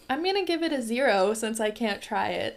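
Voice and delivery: normal talking voice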